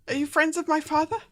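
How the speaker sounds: put-on high, loud girl's voice in alarm; frightened, panicked girl. Game master voices a lost girl's spirit, afraid and panicked. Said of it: "she sounds, uh, quite afraid and quite panicked".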